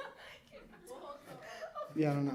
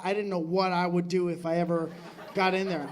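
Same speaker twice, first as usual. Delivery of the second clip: usual speaking voice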